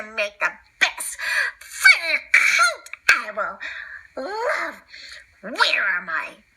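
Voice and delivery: slow, drawn-out disdainful diva; haughty slow villainess; Cruella villainess. Acted. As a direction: sneer at filthy dogs, drawling. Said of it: "Cruella said".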